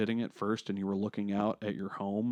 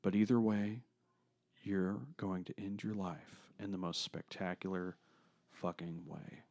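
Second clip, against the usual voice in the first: hushed, dramatic question; 5 semitones lower, 9 dB quieter than usual. Shown as a demonstration. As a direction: lower and softer, building suspense. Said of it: "I wanna ask a question".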